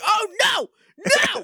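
high-pitched panicked shout, hammed-up; mock freak-out. Rangers reacting to the wrist claw, mock panicked shout. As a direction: shriek it like a comically alarmed team. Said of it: "freak out about this".

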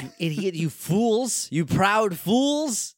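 hammy slow villain shout; mock villain rant. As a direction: ham it up like a cartoon villain. Mock villain rant at game makers.

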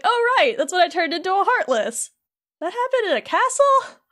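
mock dawning surprise, pitched high; surprised realisation. Retelling Sora remembering the castle, surprised realisation. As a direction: play a character suddenly remembering, voice jumping up.

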